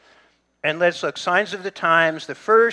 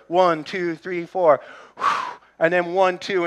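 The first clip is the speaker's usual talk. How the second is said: coaching count; 4 dB louder than usual. Retold or imitated. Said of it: "I'm going to her".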